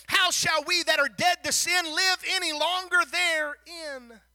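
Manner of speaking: loud, ringing sermon voice; fervent preaching. Acted. Preacher challenging the congregation about sin, loud preaching. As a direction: lift it back up and press the point. Preacher returns to full force.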